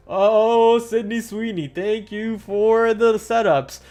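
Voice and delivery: gleeful, loud thank-you; excited. Real, not acted. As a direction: celebrate the setup, drawn out.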